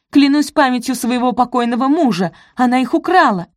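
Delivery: high, loud outraged oath; shrill sworn accusation. A narrator's reading. Araminta swearing to the judge it was theft, shrieking. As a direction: swear it at full pitch.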